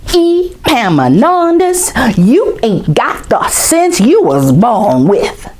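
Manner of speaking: high drawn-out call of his name, then a slow scolding; a fed-up mother with hands on hips; exasperated mama. Acted. Mama scolds her boy Epaminondas, slow and exasperated. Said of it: "what his mama says when she gets really mad".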